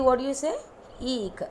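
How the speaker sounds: ordinary talk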